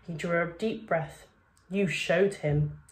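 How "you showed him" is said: usual speaking voice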